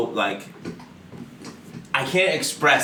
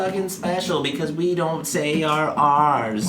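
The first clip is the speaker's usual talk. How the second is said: mock smug local; 5 semitones higher, slower than usual. Mocking Massachusetts people.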